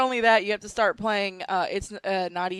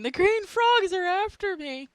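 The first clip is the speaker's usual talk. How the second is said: high, drawn-out cry of mock panic; mock panicked scream; 11 semitones higher, 3 dB louder, slower than usual. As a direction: wail it like a man driven insane. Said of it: "start screaming".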